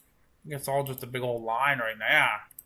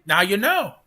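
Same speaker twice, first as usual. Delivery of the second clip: emphatic; 7 semitones higher, 7 dB louder than usual. Shown as a demonstration. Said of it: "repeated line".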